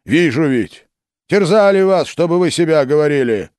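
high, loud outcry; indignant shout. A narrator's reading. Philip shouting at the confessing boyars. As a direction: insist they were tortured. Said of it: "крикнул Филипп".

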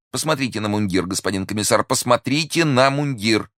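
high, agitated pleading argument; exclaiming insistently. A narrator's reading. Prisoner proves to the commissioner it's the wrong man, exclaiming insistently. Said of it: "воскликнул в свою очередь Бонасье".

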